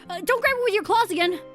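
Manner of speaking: loud, high-pitched call; urgent warning. Acted. Agent stopping Klutzy grabbing the ball, urgent call. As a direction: call out to stop them quickly.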